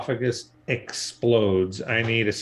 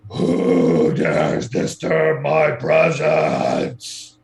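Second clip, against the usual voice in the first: slow, booming giant's challenge; ancient rasping undead king; 5 dB louder, much slower than usual. Acted. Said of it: "And he shouts".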